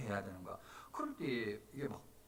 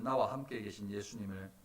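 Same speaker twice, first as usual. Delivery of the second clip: usual speaking voice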